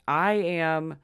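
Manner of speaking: normal talking voice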